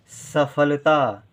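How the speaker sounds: सफलता stretched syllable by syllable, very slowly; slow spelling-out. Shown as a demonstration. Teacher spelling a new word for pupils, very slow. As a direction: sound out सफलता slowly for the class. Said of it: "ध्यान दें".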